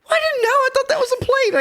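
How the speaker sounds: high, loud, innocent excuse; defensive husband. Retold or imitated. Husband explaining himself at the banquet, high, loud, defensive. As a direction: plead ignorance like the husband. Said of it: "He was like".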